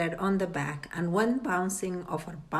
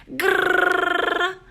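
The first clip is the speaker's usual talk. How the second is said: high, loud growl; angry growl; 11 semitones higher, 6 dB louder than usual. A narrator's reading. Father growling at the fish, angry growl. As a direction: growl in fury.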